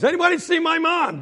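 high, loud call to the lookouts. Acted. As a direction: holler it up the fence. Boy calls to lookout friends.

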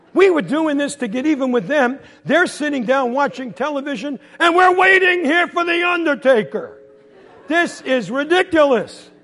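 exasperated rant. Acted. Kid stuck waiting while parents watch television, indignant rant. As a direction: rail at the injustice.

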